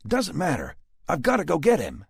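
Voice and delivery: high, loud angry insistence. A narrator's reading. Rick to Dallas about his father, angry. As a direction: refuse to back down, angry. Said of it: "said in an angry tone".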